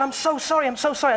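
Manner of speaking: high-pitched retold apology; mock flustered apology. Retold panicked apology backstage.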